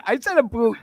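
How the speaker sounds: mock him high and loud with "a boot"; mocking Canadian "a boot". Teasing the Canadian friend's slip, mockingly high "a boot". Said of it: "Listen to him".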